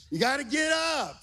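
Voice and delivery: high shouted urging; imitated yell. Father echoing son's cheer for a wrestler, high imitated yell. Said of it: "Luke yells out".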